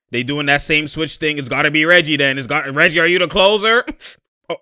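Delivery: ordinary talk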